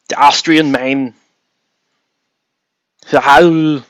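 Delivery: put-on lightly done Austrian accent; a half-hearted Schwarzenegger-style Austrian accent; shitty Governator impression. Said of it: "that's my shitty Governator impersonation".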